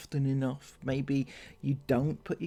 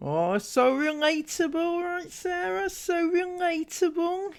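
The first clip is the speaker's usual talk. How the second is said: much higher, mock-adoring so relatable; sarcastic gushing. Commentator mocking the vlogging mum, sarcastic much higher gush. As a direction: gush over it while meaning the opposite.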